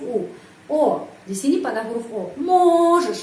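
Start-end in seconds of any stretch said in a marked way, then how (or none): 2.4-3.2 Можешь loud and higher, stress on the O; stress demo. Shown as a demonstration. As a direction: punch the first O of Можешь. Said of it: "karena itu huruf O".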